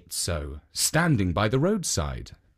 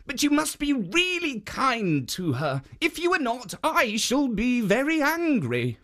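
higher, stern guardian warning; raised stern guardian; warning statue voice; 11 semitones higher than usual. Acted. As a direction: warn the ogre to be kind. Jitso warns the ogre.

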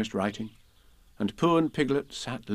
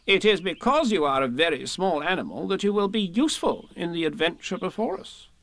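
higher, emphatic Rabbit voice; lightly voiced officious Rabbit; 7 semitones higher than usual. Rabbit persuades Piglet he will be useful, lightly officious. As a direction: declare it importantly.

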